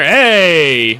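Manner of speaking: loud, drawn-out cheering shout; excited cheer. Real, not acted. Reactor cheers a blocked shot, excited cheer. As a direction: call out in delight at the play.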